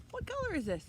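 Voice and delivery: excited. Acted. Quizzing children excitedly.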